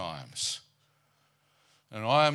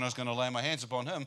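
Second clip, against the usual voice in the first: ordinary talk, nothing marked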